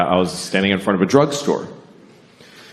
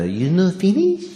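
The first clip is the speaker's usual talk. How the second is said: put-on high, slow Japanese-accented question; mock Japanese-accented waitress; 9 semitones higher, 3 dB quieter than usual. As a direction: put on a Japanese accent, pitch climbing steeply. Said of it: "I have to do the accent".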